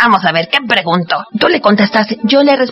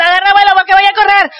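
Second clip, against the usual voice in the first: excited shout; 7 semitones higher, faster than usual. Acted. Dinosaur calling out to the child while running to hug. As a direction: yell it fast as you charge.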